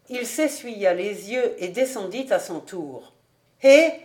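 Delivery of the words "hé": shouted hey across the hall; calling out. A narrator's reading. Innkeeper calling after the travellers, loud hey. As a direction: call them back before they leave. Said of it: "leur cria Schneegans".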